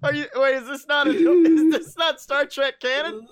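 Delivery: high, loud incredulous questioning; cracking up while asking; real laughter breaking into the words; laughing disbelief. Real, not acted. Cracking up at the absurd character.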